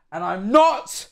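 high, loud protest on the last word; shouted high-pitched denial; defensive outburst. Real, not acted. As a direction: squawk the denial, rising high. Heads off being called insane.

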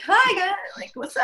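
high, bubbly vlogger voice; chirpy YouTuber hello; mock vlogger greeting. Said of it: "I'm so awkward though".

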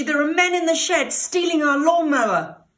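high, loud, excited warning; urgent shout. Acted. Pig telling his mother about the robbers, shouting. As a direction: call out the alarm. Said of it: "shouted Belcher".

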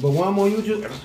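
ordinary talk

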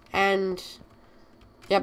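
ordinary delivery

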